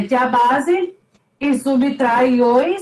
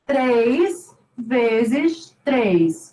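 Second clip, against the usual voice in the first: slow spelled-out count; much slower than usual. Shown as a demonstration. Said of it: "É o três multiplicado duas vezes".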